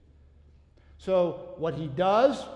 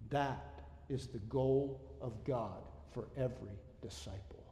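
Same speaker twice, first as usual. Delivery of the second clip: softer, intimate sermon voice fading to a near whisper; hushed preaching; 7 dB quieter than usual. Acted. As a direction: draw them in quietly. Sudden hushed sermon section.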